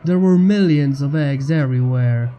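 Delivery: plain reading voice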